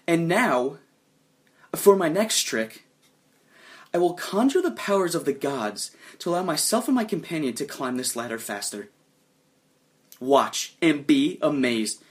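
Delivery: loud stage magician announcing a trick; showman's spectacle. Acted. Sacrifice turns to face the crowd, showman's spectacle. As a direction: play to the crowd, drawing out key words. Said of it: "make a complete spectacle of this bullshit".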